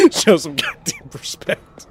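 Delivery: high, loud, slow laughing demand; laughing mock outrage. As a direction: ham up the indignation while laughing.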